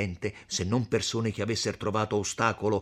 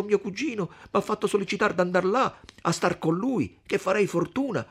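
put-on higher, quicker voice of an eager young man; eager young Renzo; 12 semitones higher, 4 dB louder than usual. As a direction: sell the dream of the Bergamasco with youthful zeal. Narrator voices Renzo at length. (voce di Renzo giovane e infervorato, più acuta)

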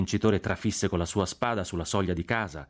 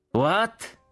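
high, rising yelled question; shouted over din; 12 semitones higher, 3 dB louder than usual. A narrator's reading. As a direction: yell it over the roar of tank tracks. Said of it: "gridò il generale Cork nel terribile fragore". (domanda urlata nel fragore)

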